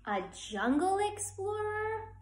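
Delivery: put-on high, bright child's question; child guessing in a bedtime story; eager child. A narrator's reading. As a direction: ask like an excited little kid.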